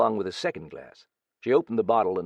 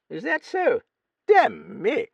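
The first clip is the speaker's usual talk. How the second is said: put-on high, louder crusty old lady; old Duchess muttering; 14 semitones higher, 7 dB louder than usual. A narrator's reading. Old Duchess doubting the young writer, high gruff voice. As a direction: grumble it like a gruff dowager. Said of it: "she muttered".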